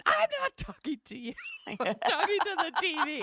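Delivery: high, loud, laughing reply; answering her husband from another room, laughing and loud; laughing retort. Retold or imitated. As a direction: call back to the other room through laughter. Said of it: "He's like".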